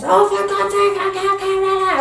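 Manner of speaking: put-on high, loud childish wail of frustration; frustrated child. Child who flipped their lid, whining at the task, high and loud. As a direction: whine it out like a kid who can't get it. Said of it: "if a child flips their lid and goes".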